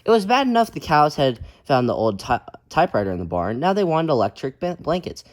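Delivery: lively, loud storybook reading; reading to children. A narrator's reading. Teacher reading a picture book aloud to kids, lively. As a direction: read it out big for the kids.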